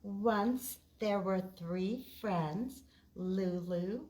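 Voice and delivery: ordinary delivery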